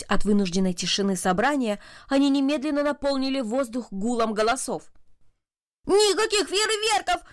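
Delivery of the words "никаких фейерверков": put-on high-pitched boyish outcry of protest; schoolboy exclaiming in outrage; indignant exclamation. A narrator's reading. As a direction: cry out in protest, pitched well up. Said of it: "воскликнул один".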